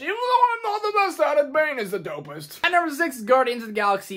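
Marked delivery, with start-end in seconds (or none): 0.0-2.6 higher, muffled masked-villain voice; self-mocking Bane voice. Shown as a demonstration. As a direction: slip into Bane while apologising. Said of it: "I love doing his voice so much".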